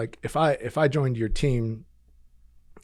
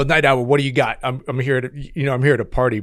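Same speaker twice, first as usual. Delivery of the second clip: eager pilot; 7 dB louder than usual. Retold or imitated.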